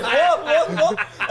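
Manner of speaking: high, laughing questions; excited what. Retold or imitated. Asking James what, laughing and high. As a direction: fire them off, delighted. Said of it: "I was like".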